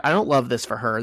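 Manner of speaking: ordinary talk, nothing marked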